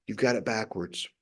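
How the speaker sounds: soft echoed You've got it backwards. Shown as a demonstration. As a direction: let You've got it backwards drop to a hushed echo. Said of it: "repeated softer".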